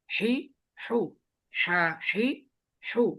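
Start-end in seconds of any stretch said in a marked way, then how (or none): none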